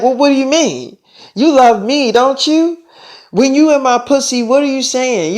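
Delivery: indignant, pleading challenge; acted confrontation. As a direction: press a lover for answers. Lover confronts him, hurt and demanding.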